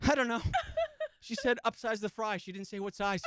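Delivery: flustered customer. Retold or imitated. Husband at the Wendy's counter, baffled and flustered. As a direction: sputter like a lost husband at the counter. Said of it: "he goes".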